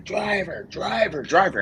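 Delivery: a mild mock yell of driver, driver, driver; retold screaming. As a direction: mimic an impatient agent yelling for the driver. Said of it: "customs agent screaming".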